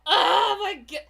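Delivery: loud, near-crying outburst; panicked exclamation. Real, not acted. Streamer reacting to her freezing computer, loud panicked cry. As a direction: blurt it out half-sobbing.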